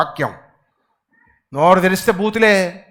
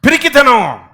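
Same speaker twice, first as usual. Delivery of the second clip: పిరికితనం high and loud; 6 semitones higher, 5 dB louder, slower than usual. Real, not acted. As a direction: call it out. Word loud then soft. (గట్టిగా)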